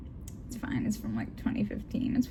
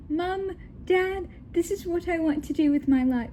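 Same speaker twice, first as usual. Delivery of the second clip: high-pitched daughter with a hint of British accent; lightly attempted British accent, pleading pitch raised; British accent, first attempt; 8 semitones higher than usual. Daughter pleads with her parents in a half-committed British accent. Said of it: "let me do the British accent".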